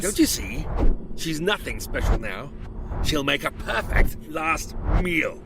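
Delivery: loud, hungry menacing creature; gloating monster. Acted. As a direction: savour the thought of eating her. Creature gloating over its meal.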